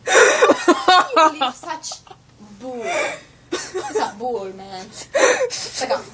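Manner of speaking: slow, loud hammed-up wailing; mock wail. Forgetting the paintbrush, hammed-up slow wail. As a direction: wail it out like a soap opera.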